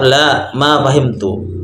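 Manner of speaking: La, ma fahimtu said slowly and deliberately; slow model answer. Shown as a demonstration. Teacher modelling a negative answer slowly for students. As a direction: spell out La, ma fahimtu at half speed. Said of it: "Atau dengan menjawab".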